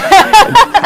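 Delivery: loud, high laugh; hearty high laugh; high burst of laughter. Real, not acted. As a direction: laugh out at the memory. Laughing at own story.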